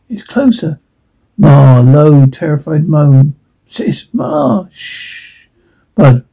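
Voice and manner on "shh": whispered shush; hushing.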